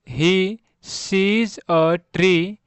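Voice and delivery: each word read out very slowly for learners; slow reading aloud. Shown as a demonstration. Teacher reading a sentence slowly to pupils, slow. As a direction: spell out the sentence slowly to students.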